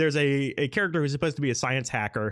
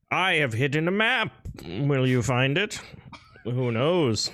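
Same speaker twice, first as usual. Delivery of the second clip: grand proclamation, slowing on the question; teasing old-time herald; town crier. Acted. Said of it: "he is dressed as Ben Franklin".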